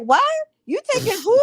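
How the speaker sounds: indignant woman. Retold or imitated. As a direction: play a woman who can't believe what she heard. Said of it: "A some woman be like".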